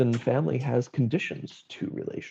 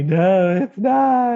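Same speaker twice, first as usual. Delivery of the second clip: mock tearful wail; 9 semitones higher, 6 dB louder, much slower than usual. Mom's tearful denial.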